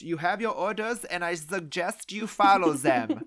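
mock Germanic villain accent; Burgermeister. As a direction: give orders in a hammed-up German accent. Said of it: "sounds like the Burgermeister".

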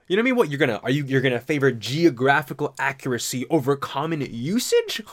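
usual speaking voice

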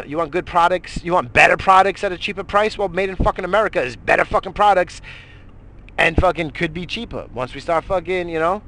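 ordinary talk

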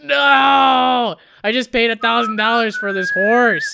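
loud, high cry of despair; anguished shout. Retold or imitated. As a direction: yell it out in dismay. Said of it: "you're just like".

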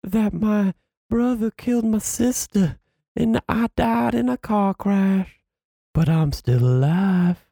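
recapping the movie, imitating Lori's creepy confession; mock dramatic confession. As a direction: ham up her spooky reveal. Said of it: "she's like".

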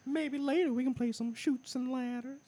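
very high old-creep cartoon voice; high, whistly come-hither impression; Herbert. Joining in with the Herbert lines, high creepy voice. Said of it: "he'd do the Herbert voice".

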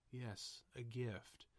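very soft, quiet reading; hushed Bruce quote. Retold or imitated. Host quoting Bruce receiving a gift, hushed. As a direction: gently imitate a hushed film line. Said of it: "really nice for Bruce".

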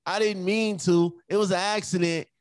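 mock kid's plea; kids' denial. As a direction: mimic a child protesting innocence. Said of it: "the first thing they'll say".

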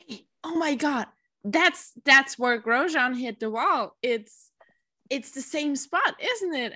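high, lightly voiced husband, surprised; a hint of an amazed husband pointing it out; husband's excited question. Recalling her husband spotting the crash site, lightly excited. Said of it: "my husband was like".

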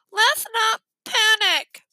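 slow, high head-cold delivery; stuffed-nose voice. A narrator's reading. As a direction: urge order while pinching your nose. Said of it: "they had the worst colds".

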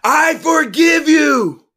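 slow, high, full-out shout; drawn-out, impassioned yell. A narrator's reading. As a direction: shout forgiveness with everything you have. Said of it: "Louder, the voice said".

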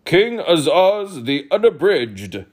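slow, loud herald proclaiming; formal announcement. Acted. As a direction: announce the king grandly. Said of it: "a page announced".

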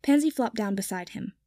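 usual speaking voice

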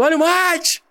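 high singsong street call; vendor call. Retold or imitated. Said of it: "aí tu vai gritando".

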